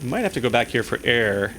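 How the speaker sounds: ordinary talk, nothing marked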